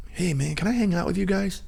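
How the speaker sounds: high, eager newcomer's voice; young kid. Retold or imitated. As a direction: imitate a young kid asking to tag along. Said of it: "this young kid walks up".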